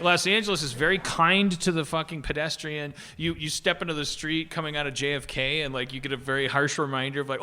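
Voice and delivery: ordinary talk, nothing marked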